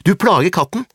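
put-on high, loud girl's protest; acted furious child's shout; angry shout. A narrator's reading. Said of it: "da Åse ropte".

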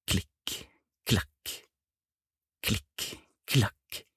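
soft, separate clicks with gaps between; distant footsteps. A narrator's reading. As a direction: sound out far-off steps softly. Distant footsteps imitated by narrator. (lave, fjerne fottrinn)

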